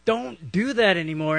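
high-pitched urging to quit; mock-exasperated plea; acted pleading objection. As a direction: play someone begging them to stop.

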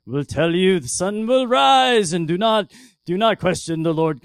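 loud, hammed-up King James preacher voice; mock pompous prophet. Mocking how a preachy prophet would answer the widow, in a loud pompous voice. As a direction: boom it out like an old-time pious prophet. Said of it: "He doesn't go".

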